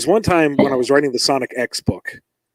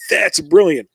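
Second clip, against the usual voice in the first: higher, louder burst of praise; imitated enthusiastic thumbs-up; delighted approval; 6 semitones higher, 5 dB louder, slower than usual. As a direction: act out an impressed editor. Acting out editor praising the idea.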